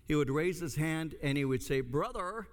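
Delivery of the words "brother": high-pitched imitation of an interrupting student; put-on, higher "Brother"; classmate's interjection. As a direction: mimic the classmate's habitual "Brother". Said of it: "he said it like that".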